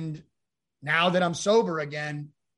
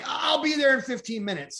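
higher, raised shout of reassurance; hurried shout; 8 semitones higher, 4 dB louder than usual. Retold or imitated. Promising his ex-wife he's coming, hurried and raised. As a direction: call out that you're on the way. Frantic reply on phone.

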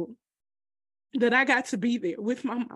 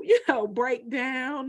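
tearful laugh; 5 semitones higher, 3 dB louder, slower than usual. Real, not acted. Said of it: "I just had my little".

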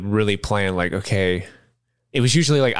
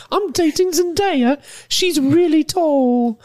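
put-on very high, slow squeaky British boy voice; a pleading little schoolboy dodging questions; Tom Holland impression; 4 dB louder, much slower than usual. Mocking Tom Holland in interviews, squeaky slow voice. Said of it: "that's Tom Holland to me".

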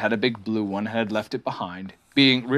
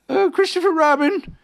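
high, loud bear call; 5 dB louder than usual. A narrator's reading. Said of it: "then he called down to you again".

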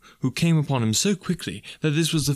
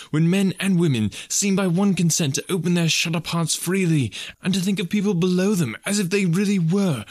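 higher-pitched, impassioned festive speech; earnest young nephew; 5 semitones higher than usual. Acted. Nephew defending Christmas to his uncle, impassioned. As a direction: build a heartfelt case for Christmas.

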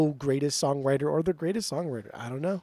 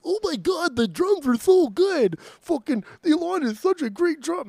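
much higher, louder gushing voice; mock fanboy gushing; 13 semitones higher, 6 dB louder than usual. Imitating co-host gushing over the drums, high and loud. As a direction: overblown fanboy swoon.

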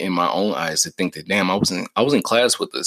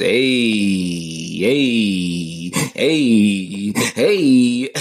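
drawn-out "ayy"s climbing higher and louder; rising "ayy"s; 4 dB louder, much slower than usual. Shown as a demonstration. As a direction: stretch each "ayy", pitching it up every time. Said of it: "the A's just get higher and higher".

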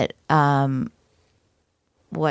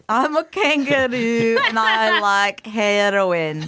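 put-on drawn-out comic Scottish accent; Scottish Trainspotting kangaroo. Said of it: "what if that kangaroo was in Trainspotting?".

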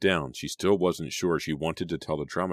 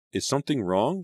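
higher-pitched, insistent question; demanding; 9 semitones higher, 4 dB louder than usual. A narrator's reading. Girl questioning her downcast friend, demanding. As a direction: press for an answer with concern. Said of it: "she demanded".

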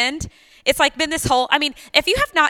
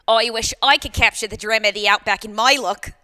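put-on Australian commercial read; Australian accent. Shown as a demonstration. Contestant's CoverGirl commercial recreated, mock Australian accent. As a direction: act a cosmetics ad in broad Australian. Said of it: "do my Australian accent".

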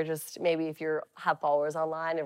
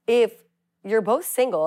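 usual speaking voice

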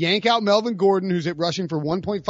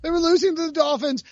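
exasperated rant; 10 semitones higher than usual. Real, not acted. Ranting about hated team.

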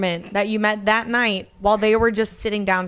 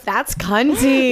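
drawn-out praise; 3 semitones higher, 5 dB louder, much slower than usual. Real, not acted. Drawn-out approval.

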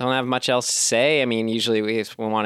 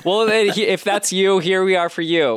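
excited; 10 semitones higher, 5 dB louder than usual. Acted. Greeting imagined listener loudly.

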